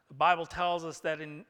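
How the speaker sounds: impassioned pulpit cry; full-force preaching. Real, not acted.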